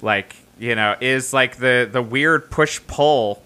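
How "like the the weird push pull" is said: usual speaking voice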